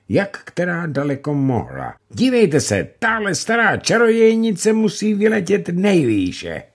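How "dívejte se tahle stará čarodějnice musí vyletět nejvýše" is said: put-on much higher, louder boyish cry; excited exclamation. Míla calling to the crowd before his throw, loud and high. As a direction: show off the throw to everyone. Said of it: "zvolal Míla".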